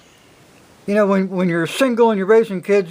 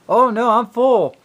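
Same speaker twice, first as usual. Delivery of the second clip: mimicking the guest; 6 semitones higher than usual. Moocher guest's reply voiced.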